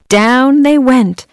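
ordinary delivery

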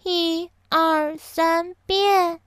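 high, drawn-out fairy count; 仙女念咒. A narrator's reading. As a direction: chant the spell in a lifted voice. 仙女第四次施魔法. (仙女用高音拉长念咒)

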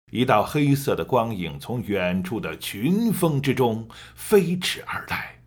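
one long breath, stress on 群; all in one breath. Shown as a demonstration. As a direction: sweep through it without pausing. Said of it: "我可以给你做一个演示".

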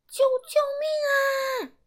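high, slow, desperate shout; crying for help. Acted. Boy caught by the monster, shouting for help. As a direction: scream for help in a monster's grip.

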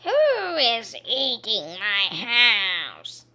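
slow, growling witch demand; angry witch. Acted. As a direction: loom over them as the witch. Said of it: "she shouts".

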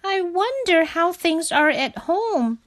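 high, wistful little frog; high-pitched, homesick wondering. A narrator's reading. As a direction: muse longingly in a high voice. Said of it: "he said wistfully".

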